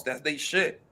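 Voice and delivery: normal talking voice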